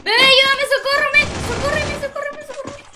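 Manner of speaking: pide auxilio a gritos, mucho más agudo; súplica desesperada y aguda, cada vez más ahogada; pánico real. Real, not acted. Pide ayuda en plena partida. (grito de auxilio agudo que se apaga al final)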